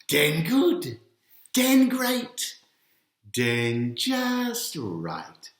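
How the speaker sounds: proud bear voice. A narrator's reading. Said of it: "he says".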